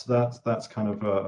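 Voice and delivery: ordinary talk, nothing marked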